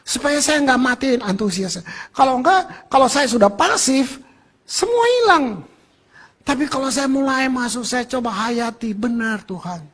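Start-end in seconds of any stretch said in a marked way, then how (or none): 4.6-5.7 high, slow, drawn-out; emphatic lament. Acted. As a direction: stretch it to show all is lost. Enthusiasm dies when passive. (penekanan dramatis)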